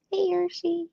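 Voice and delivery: high, sing-song hello to a cat; high cooing greeting to the kitty; sweet pet greeting. Real, not acted. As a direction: coo at the cat like a pet-parent.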